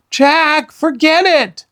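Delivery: put-on high, loud girl's call; pleading call. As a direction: beg him to come back. Annie pleading him to come back.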